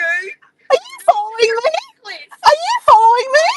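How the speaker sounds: high, loud laughing call; yelling from the car to a friend, high and laughing; playful shout. Real, not acted. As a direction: tease a friend across the street.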